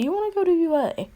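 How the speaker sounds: high, small voice, chirpy; squeaky little creature. Acted. Nezu inviting the boy to the school, high and squeaky. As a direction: voice the tiny animal principal. Tiny principal on shoulder asking.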